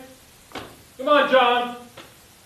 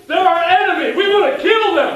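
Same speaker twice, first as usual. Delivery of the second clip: pep talk shout; 5 semitones higher, 5 dB louder than usual. Acted. Coach firing up the team.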